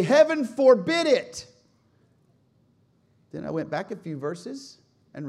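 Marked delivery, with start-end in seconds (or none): 0.0-1.5 slow, high, shouted prohibition; loud rebuke. Acted. Peter looking at Jesus, loudly forbidding it. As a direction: cry it out in outraged protest.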